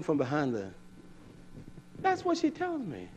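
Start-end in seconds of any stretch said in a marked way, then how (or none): 2.0-3.1 much higher mock wife voice; voicing his wife. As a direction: squeak it like the wife. Quoting wife high.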